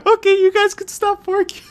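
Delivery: high-pitched laughing yell; laughing shout. Real, not acted.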